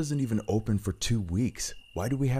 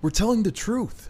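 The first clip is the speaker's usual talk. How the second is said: desperate plea; 13 semitones higher than usual. Acted. Pleading to be believed.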